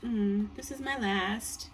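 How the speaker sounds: tired, slow drawn-out voice. Real, not acted. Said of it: "I'm tired".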